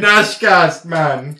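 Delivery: mock Jamaican accent. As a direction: shout it out in a hammed-up Jamaican accent. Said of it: "that was Jamaican".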